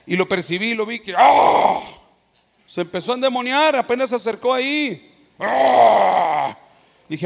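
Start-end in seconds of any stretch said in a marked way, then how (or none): 1.2-1.8 demonic gasp. Retold or imitated. Man turns possessed near pastor. 5.4-6.6 a harsh, strange-voiced cry of a possessed man; possessed man's sudden cry; demonic cry.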